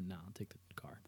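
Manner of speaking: breathy whispered answer; whispering. Real, not acted. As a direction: say it under your breath. Quiet aside answer.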